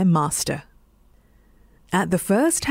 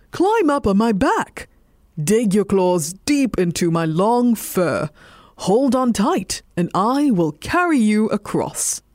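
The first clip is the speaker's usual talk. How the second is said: steady the cat with calm confidence; lightly voiced reassuring dog. A narrator's reading. Dog offering the cat a ride, reassuring. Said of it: "replied the dog".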